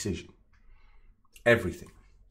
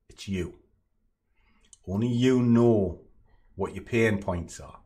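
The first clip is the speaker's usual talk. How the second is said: ordinary delivery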